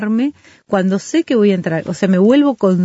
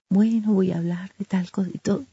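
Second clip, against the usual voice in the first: much softer, imitating a teacher; lowered teacher voice; 8 dB quieter than usual. Teacher to a noisy class, deliberately hushed. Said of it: "Hay mucho ruido y los maestros dicen".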